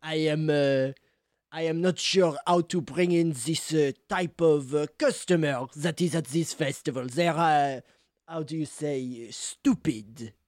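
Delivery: put-on halting French-accented English. Cheese seller discussing festival customers, French accent. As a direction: grope for the word in a French accent. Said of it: "French accent and all".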